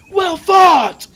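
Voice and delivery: high, loud repeated cheering shout. Acted. Lords in the viewing stand hailing Valar, shouting. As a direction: bellow the praise from the stands.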